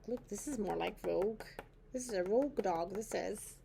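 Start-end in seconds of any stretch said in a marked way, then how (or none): none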